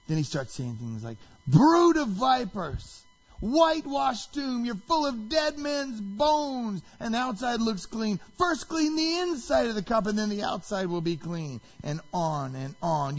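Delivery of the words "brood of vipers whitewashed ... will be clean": high, slow, ringing denunciation; really loud. Retold or imitated. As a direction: hurl it out like an accusation. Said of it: "then it gets really loud".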